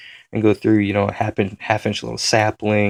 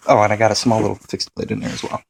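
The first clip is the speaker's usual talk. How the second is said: ordinary talk, nothing marked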